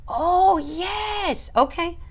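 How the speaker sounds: high-pitched delighted "oh yes"; light up at remembering the photo; excited recognition. Real, not acted. Host recalling a viewer's email, excitedly delighted.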